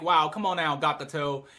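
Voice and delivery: low, soft letdown; disappointed. Retold or imitated. Viewer let down by Gakuto and Raji, disappointed. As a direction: chide them quietly, voice sunk. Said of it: "I was very disappointed. I was really mad".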